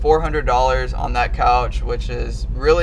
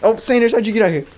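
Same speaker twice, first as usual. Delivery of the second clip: pet talk; 8 semitones higher, 3 dB louder, much faster than usual. Real, not acted. Talking to the dog.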